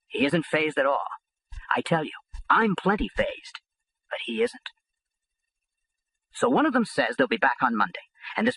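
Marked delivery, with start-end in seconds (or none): none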